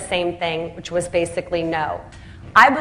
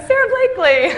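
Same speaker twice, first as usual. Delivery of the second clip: much higher and louder, squeaky mock insistence; exasperated punchline; 13 semitones higher, 4 dB louder than usual. Telling manufacturers she is her own backer, squeaked up high. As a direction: pop the name up high like the obvious answer. Third time, punchline of the story.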